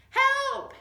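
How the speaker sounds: loud straining cry for help. Acted. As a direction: cry out desperately. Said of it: "he cried".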